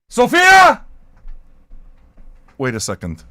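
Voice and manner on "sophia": loud high shout across the room; calling a name at full voice. Real, not acted. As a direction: yell the name to reach him. Shouting for someone offstage.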